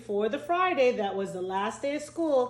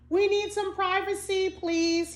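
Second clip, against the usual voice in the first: higher, lightly voiced kid asking politely; a schoolboy's high polite please; polite child request; 10 semitones higher, 3 dB louder than usual. A narrator's reading. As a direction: ask the teacher for privacy like an eager pupil. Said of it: "he said".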